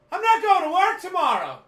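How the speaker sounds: high, very loud triumphant yell; jubilant shout. Retold or imitated. Son celebrates a huge win, jubilant shouting. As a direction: holler it like you just won big.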